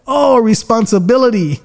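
like lightning just struck you; sudden realization. Retold or imitated. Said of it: "God just shot thunderbolts through my soul".

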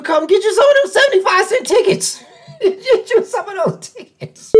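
high, laughing salesman spiel; mock-sell the cheap tickets through laughter; laughing mock pitch. Real, not acted. Vlogger teases the cheap AEW tickets, laughing high. Let me calm down.

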